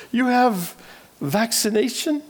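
high, slow, unsure question; hesitant broken English. Retold or imitated. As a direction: ask timidly in halting English. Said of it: "I hesitantly, ... my broken English".

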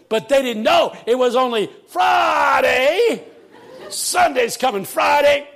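shouted, high preaching; preacher taking off. Retold or imitated. Pastor proclaiming Sunday's coming, shouted preaching. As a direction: imitate a pastor crying out to the church. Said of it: "He took off".

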